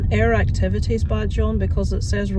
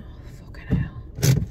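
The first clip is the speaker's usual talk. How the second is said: whispered real frustration, slowing at the end; frustrated whisper; 7 dB quieter, slower than usual. Real, not acted. Waiting in the car for her dad, whispered frustration. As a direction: breathe it out under your breath in exasperation.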